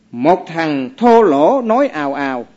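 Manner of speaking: slow chanted verse line; reciting verse. Acted. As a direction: intone it like a scripture verse. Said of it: "ông đọc như thế này". (đọc kệ chậm rãi)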